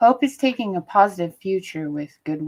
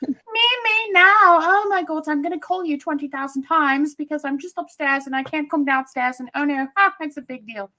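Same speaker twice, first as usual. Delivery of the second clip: put-on much higher, louder whiny kid voice; mother mimics her whining teenager; imitating daughter; 10 semitones higher, 5 dB louder than usual. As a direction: play the demanding teenage daughter. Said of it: "my daughter's like".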